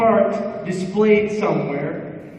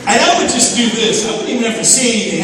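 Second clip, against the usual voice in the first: ordinary delivery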